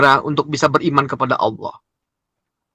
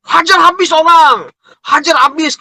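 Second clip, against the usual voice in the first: high, raised-voice harsh command; mock battle cry; 10 semitones higher than usual. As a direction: cry it out high like a zealot attacking. Mimics harsh approach to others. (seruan garang tiruan bernada tinggi)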